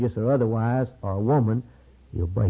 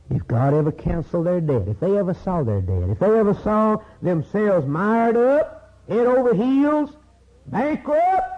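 impassioned preaching; 6 semitones higher, 4 dB louder than usual. Real, not acted. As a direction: climb steadily to a high pulpit cry. Preaching builds to climax.

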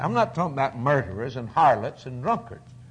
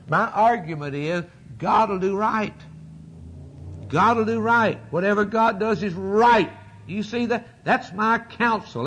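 full-force preaching; 5 semitones higher than usual. Acted. Preacher answering a caller before the congregation, full-force preaching. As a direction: preach it out, building to the verdict.

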